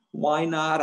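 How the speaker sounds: put-on high, loud childish whine; whining. A narrator's reading. Boy objecting to his mother's no, whining. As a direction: whine it like a thwarted kid. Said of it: "I whined".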